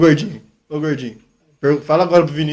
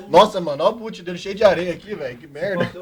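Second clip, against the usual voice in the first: normal talking voice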